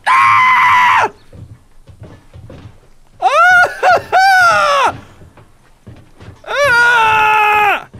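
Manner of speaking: high drawn-out wailing scream; frustrated screams. Real, not acted. Player lost in the game, wailing screams.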